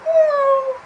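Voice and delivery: very high, loud mewing cry; cat meow. Acted. Puppet Will showing the viewers a cat's meow, very high. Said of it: "parinig mo sa kanila".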